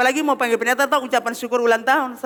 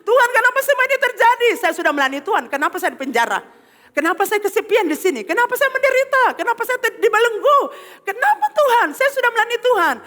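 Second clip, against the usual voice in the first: high, pleading complaint to God; Paul's mock lament; 9 semitones higher than usual. Preacher voicing Paul complaining to God, high and pleading. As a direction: cry out like a wronged prisoner asking God why.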